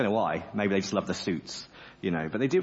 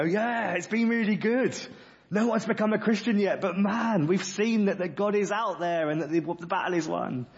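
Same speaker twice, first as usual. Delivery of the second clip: cheery returning evangelist; 10 semitones higher than usual. Retold or imitated. Chariot riders returning cheerfully.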